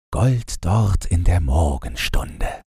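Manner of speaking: ordinary delivery, nothing marked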